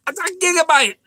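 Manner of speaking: can you believe it, a whole gigabyte; squeaky outrage at the waste; indignant disbelief. Real, not acted. Outraged at lost download.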